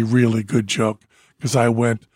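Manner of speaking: ordinary delivery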